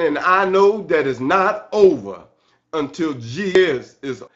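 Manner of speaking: ordinary talk, nothing marked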